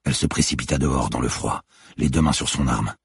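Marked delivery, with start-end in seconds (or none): none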